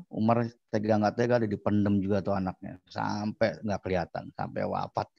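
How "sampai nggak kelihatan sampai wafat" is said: ordinary talk, nothing marked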